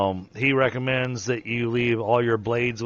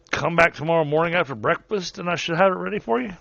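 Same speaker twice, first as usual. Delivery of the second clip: higher-pitched, lively working craftsman; forge master; 7 semitones higher than usual. Acted. Forge master promising the party their gear after breakfast, pitched-up character voice. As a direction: play the smith, voice pitched up. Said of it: "then he's like".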